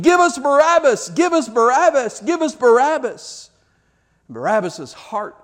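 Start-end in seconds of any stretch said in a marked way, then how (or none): 0.0-3.5 higher, loud repeated chant; crowd screaming. Retold or imitated. Mob screaming for Barabbas at Pilate, loud chant. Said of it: "they're screaming".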